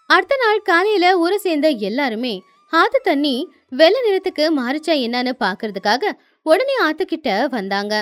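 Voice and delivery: plain reading voice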